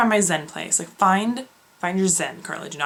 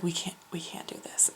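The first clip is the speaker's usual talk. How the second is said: softer, desperate whisper; 2 semitones lower, 14 dB quieter than usual. Retold or imitated. Friend telling Carly she can't stay, desperate whisper. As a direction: plead it under your breath.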